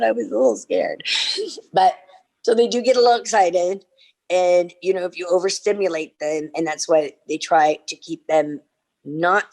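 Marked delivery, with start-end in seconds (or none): none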